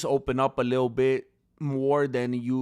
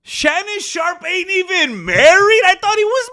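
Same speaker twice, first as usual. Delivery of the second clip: incredulous shout; 14 semitones higher, 11 dB louder than usual. Real, not acted. Streamer learning Shannon Sharpe ain't married, incredulous shouting. Outburst at news he was unmarried.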